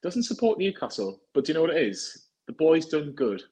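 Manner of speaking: usual speaking voice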